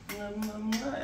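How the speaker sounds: soft high kissing sounds; blown kisses. Real, not acted. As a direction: blow kisses to the camera. Blowing kisses to viewers.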